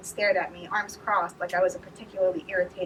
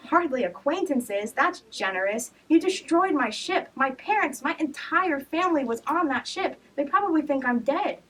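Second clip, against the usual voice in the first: high, loud and slow; bursting out in anger; angry outburst; 8 semitones higher, 4 dB louder than usual. A narrator's reading. Outburst about lost family.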